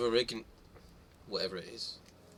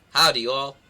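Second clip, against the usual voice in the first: bad American accent; 2 semitones higher, 7 dB louder than usual. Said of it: "Can I hear your best American accent?".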